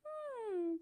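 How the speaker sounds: high soft whimper; one last small whimper. Shown as a demonstration. Coach closing the whimper demo, soft whimper. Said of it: "So that's the whimper".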